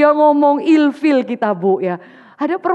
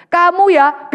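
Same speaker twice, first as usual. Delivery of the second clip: sharp, louder accusation; accusing wife; 4 semitones higher, 6 dB louder, slower than usual. Acted. Rachel blaming her husband, an accusing outburst. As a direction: point the finger and hit him with it. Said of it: "Dia langsung tuduh suaminya".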